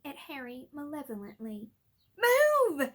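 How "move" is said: high, loud shout. Acted. Harry shouting at the gargoyle. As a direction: yell at the stone. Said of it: "Harry shouted at it".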